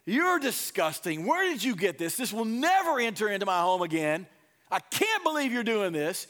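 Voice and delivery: loud, mock-furious parent scolding; freaking out.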